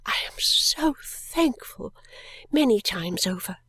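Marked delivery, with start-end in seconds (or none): 0.1-1.9 breathy, slow whispered thanks, higher and tearful. A narrator's reading. Esther alone in the coach, overcome, slow tearful whisper. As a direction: let it break into a weeping whisper.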